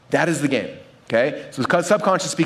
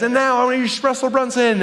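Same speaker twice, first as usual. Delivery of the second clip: higher-pitched hyped-up emcee intro; stage announcer; 8 semitones higher than usual. Retold or imitated. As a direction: announce the speaker like a hype man. Brian runs on stage announcing.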